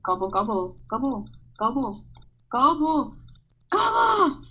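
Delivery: repeated gobbles swooping up and down; turkey gobbling. Pretend. Podcaster plays with turkey noises for fun, turkey gobbling. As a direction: gobble like a turkey, ending on a high squawk. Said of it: "Why would they call it gobbles?".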